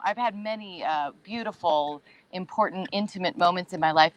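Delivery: ordinary talk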